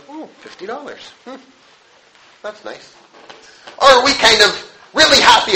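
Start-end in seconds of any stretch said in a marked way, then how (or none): 0.1-2.9 much softer, a bored shrug of a voice; mock indifference. Preacher mocking a finder of fifty dollars, bored and soft. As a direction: play it down like it barely matters.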